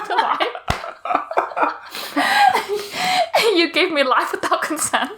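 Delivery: giggling through the words, pitch up high; laughing. Real, not acted. Friend cracking up over a mock complaint about birth, laughing. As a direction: laugh it out as the joke lands.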